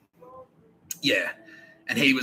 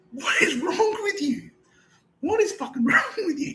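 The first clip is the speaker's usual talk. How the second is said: laughing disbelief; 4 dB louder than usual. Retold or imitated. Other inmate reacts, laughing.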